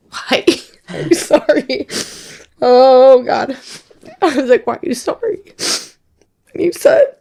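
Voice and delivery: high voice, real tears welling up; really getting emotional, pitch climbing; tearful. Real, not acted. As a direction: choke up as you retell it. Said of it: "You're gonna get emotional today".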